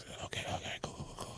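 unvoiced whisper, counting under his breath; panicked whisper to himself; nervous comic muttering his count in a whisper; whispered to self. Counting to himself before stage.

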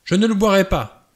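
high, loud refusal; cry out. Acted. Vera refuses the cognac from Blore, high loud outcry. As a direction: shout the refusal, pushing the glass away. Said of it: "s'écria Vera".

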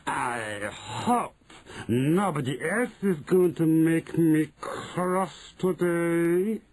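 slow menacing witch voice; witch remark. Acted. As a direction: say it coolly as the witch. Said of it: "she remarked".

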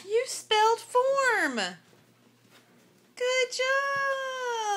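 gasp, then high, loud, drawn-out cheer; excited praise. Real, not acted. Teacher cheering a child who spelled form, thrilled praise. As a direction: celebrate it big, stretching the words way up high.

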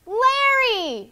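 very high, loud cry of a name; sudden call. Acted. Star suddenly spotting an old friend, high loud call. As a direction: call out the name with outstretched arms. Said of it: "she called out suddenly".

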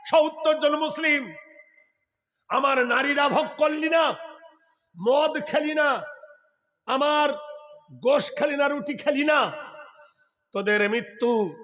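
acted royal scolding; angry king. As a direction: hurl each charge separately, pitched high. Angry king accuses the seventy Muslims.

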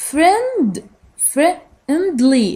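"Friend" high and stretched, then "friendly" in beats; syllable by syllable. Shown as a demonstration. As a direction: slowly sound out the parts of "friendly". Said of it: "Three syllables". (تقطيع الكلمة ببطء)

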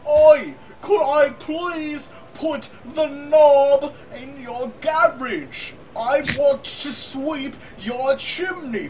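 high, very slow, loud Cockney. Retold or imitated. Lewd chimney sweep propositioning a friend, hammed-up Cockney. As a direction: drag out every word in fake Cockney.

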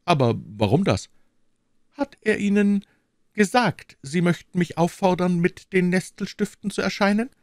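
high, pressing queen; the queen demands the truth, anxious; a frightened queen pressing the king; urgent queen. Acted.

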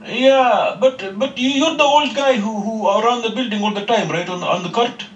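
high put-on foreign accent. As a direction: play a polite, worried tenant. Caller plays a new tenant.

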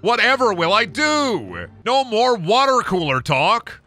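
hammed-up sarcastic lament; mock despair. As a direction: wail about remote work with fake tragedy. Sarcastic mock despair about remote work.

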